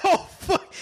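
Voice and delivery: a sudden high yelp of surprise; startled. Real, not acted. Host jolted by blaring audio, startled yelp. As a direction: jolt at a blast of sound. Audio played too loud.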